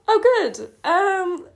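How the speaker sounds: high, loud squeal of embarrassment; mortified. Real, not acted. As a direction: blurt it out, half laughing and mortified. Cringes at own stolen poem.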